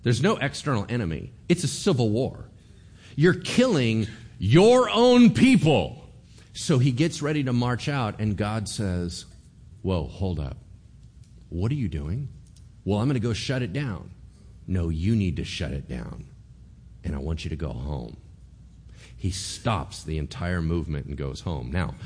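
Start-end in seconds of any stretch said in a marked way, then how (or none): 3.2-6.1 loud, slow, high stress on own; emphatic preaching. Real, not acted. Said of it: "preacher hammers the point". 9.8-18.2 calm God. Acted. God stops the king.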